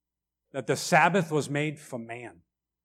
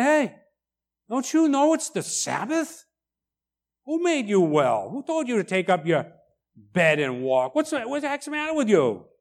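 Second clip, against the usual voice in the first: mock indignant Pharisees; 7 semitones higher than usual. Pharisees scolding the healed man, mock indignant. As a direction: ham up the leaders' outrage. Imitating upset religious leaders.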